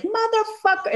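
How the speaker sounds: a sudden high mock movie-star curse; high, hammed-up punchline curse; Sam Jackson swearing. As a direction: toss the swear out like a cartoon of a tough guy. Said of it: "And he's like".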